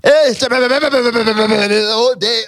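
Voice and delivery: sing-song mock call across the house. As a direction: call out like a clingy partner all day. Mock calling partner all day.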